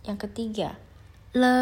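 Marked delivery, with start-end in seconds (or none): 1.3-1.6 "le" held long and level; pronunciation demo. Shown as a demonstration. Teacher asks students to follow along, drawn-out demo. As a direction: draw out "le" evenly for learners to copy. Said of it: "cara pengucapannya".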